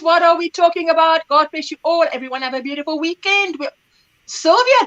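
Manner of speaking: ordinary talk, nothing marked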